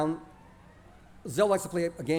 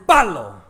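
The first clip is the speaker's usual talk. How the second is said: loud bang; 14 dB louder than usual. Retold or imitated. Firecracker explodes under toilet.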